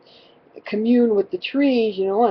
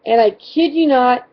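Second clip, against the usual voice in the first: usual speaking voice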